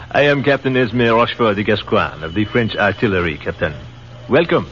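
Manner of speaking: put-on formal self-introduction in a French accent; French captain introducing himself, in a French accent. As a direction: announce the full name with pride, French accent.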